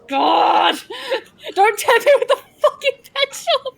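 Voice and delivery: high yelling through laughter; laughing shout. Real, not acted. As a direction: cry out while cracking up.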